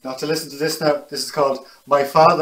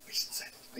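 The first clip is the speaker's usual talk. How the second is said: whisper; 20 dB quieter than usual. Pretend. Playful denial, whispered then said.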